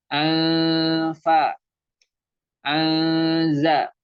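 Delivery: "Anfa" and "Anza" with long hidden N; nakis ikhfa. Shown as a demonstration. Teacher showing nakis, "Anza" with lingering blurred N. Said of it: "itu yang nakis".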